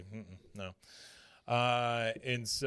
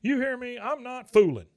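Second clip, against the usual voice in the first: very high, loud angry holler; yelling; 13 semitones higher, 9 dB louder than usual. Retold or imitated. Hank threatening the doctor outside his room, yelling. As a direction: shout a warning through the door. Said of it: "while he's yelling and banging on the door".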